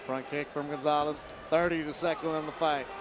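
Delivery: ordinary delivery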